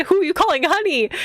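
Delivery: high, rising outrage; indignant retort. Acted. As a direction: fire it back, offended and high. Acts out offended retort.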